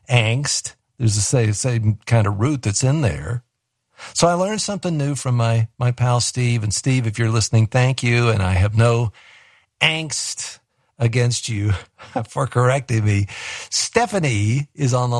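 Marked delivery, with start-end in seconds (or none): 0.1-0.7 "angst" with the same a as "anger". Shown as a demonstration. Linking it to its root, "angst" starting like "anger". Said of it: "you know, anger". 9.8-10.6 "angst" with a front a, ANKST; deliberate ANKST for "angst"; corrected pronunciation. Shown as a demonstration. Thanking Steve for the correction, "angst" with a front a. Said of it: "change the way that I'm saying".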